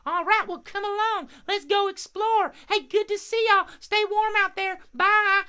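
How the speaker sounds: very high, cheery bird farewell; friendly crow puppet. Pretend. Crow leaving to go explore, high puppet voice. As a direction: say goodbye as a little cartoon crow.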